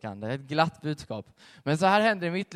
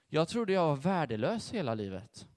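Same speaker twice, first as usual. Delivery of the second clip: normal talking voice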